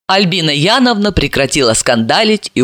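usual speaking voice

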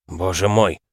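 ordinary delivery

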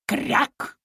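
short airy quack; duck quack. Acted. Mother duck cutting in with a quack. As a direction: snap out a duck's quack.

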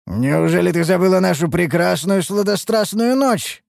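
high, melodramatic mock reproach; teasing master. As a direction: play the jilted lover for laughs. Master teases elf about their night.

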